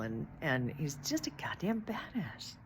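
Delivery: unvoiced, soft whisper; hushed aside. Real, not acted. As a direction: whisper it like a secret compliment. Whispered aside about Bruce.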